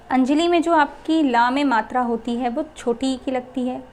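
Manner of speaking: ordinary delivery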